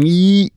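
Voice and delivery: high, squeezed "Ew"; squished-jaw vowel. Shown as a demonstration. Showing how Pennsylvanians talk, "Ew" high and squeezed. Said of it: "squishing their jaw in and going".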